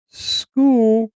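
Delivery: high, far-off call of school; mock school sound effect; school bell effect.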